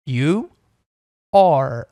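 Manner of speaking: slow, separated You then a loud are; model pronunciation. Shown as a demonstration. Teacher modelling you are for the student. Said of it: "a pronúncia é assim".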